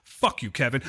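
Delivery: ordinary talk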